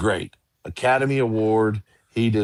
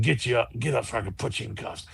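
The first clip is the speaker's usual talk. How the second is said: softer, quick muttered threat; retold whisper; 6 dB quieter, faster than usual. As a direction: lightly imitate a threat whispered in someone's ear. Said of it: "he whispers in my ear".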